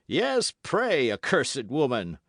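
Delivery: Baron's furious retort; angry cry. Acted. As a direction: fling the curse at her. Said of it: "cried the Baron".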